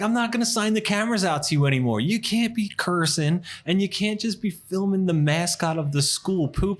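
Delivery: high, fast scolding teacher; stern teacher. Retold or imitated. Teacher refusing to lend cameras, high and exasperated scolding. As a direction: lecture them like an exasperated teacher. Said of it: "He's like".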